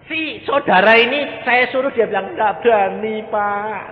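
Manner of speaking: loud, high preacher's retelling; fiery preaching. Acted. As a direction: drive the story home to the congregation. Preaching at full force. (khotbah berapi-api)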